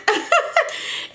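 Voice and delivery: high breathy burst of laughter; sudden high airy giggle. Real, not acted. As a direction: crack up at your own joke. Laughs at own joke.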